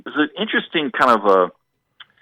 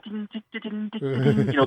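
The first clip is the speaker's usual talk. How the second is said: imitated ride cymbal; 9 semitones higher, 5 dB quieter than usual. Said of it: "you hear [name] swing it".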